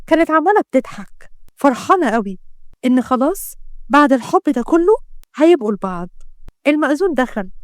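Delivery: usual speaking voice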